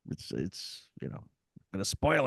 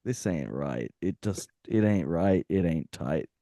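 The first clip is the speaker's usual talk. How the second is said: mock inner voice; 8 semitones lower than usual. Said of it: "I was just like".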